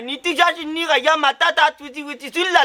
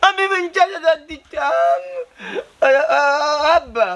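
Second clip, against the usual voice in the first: tearful lament breaking into sobs; wailing complaint. Acted. Injured man bemoaning the money he owes, tearful wail. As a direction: whine it out tearfully, dragging the last words.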